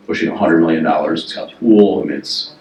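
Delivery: ordinary talk, nothing marked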